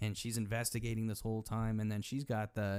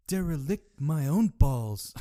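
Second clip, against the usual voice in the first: slow loud retort; 4 semitones higher, 8 dB louder, slower than usual. Retold or imitated. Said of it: "I could".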